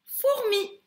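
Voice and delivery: fourmi said high and clear, stressing the result; teacher revealing the answer to a child; emphatic answer. Shown as a demonstration. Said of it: "Ce rébus, c'est".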